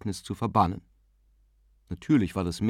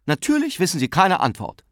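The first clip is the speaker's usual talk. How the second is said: higher, louder, needling; irritated; 9 semitones higher, 5 dB louder than usual. A narrator's reading. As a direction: snap back in irritation. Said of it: "sagte Miss Ivers gereizt".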